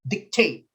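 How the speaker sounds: TATE punched in "dictate", light first syllable; second-syllable stress. Shown as a demonstration. Teacher sounding out the answer, "dictate" stressed on second syllable. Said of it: "Second syllable".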